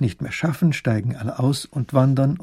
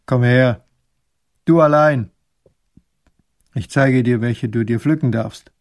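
put-on gruff old man; 6 dB louder than usual. A narrator's reading.

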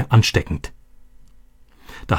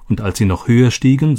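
ordinary delivery

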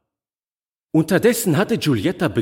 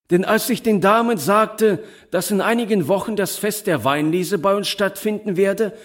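high-pitched, eager announcement; lively Herr Moriani; 8 semitones higher than usual. A narrator's reading. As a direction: speak up high as the busy host. (lebhafter Herr Moriani, mit hoher Stimme)

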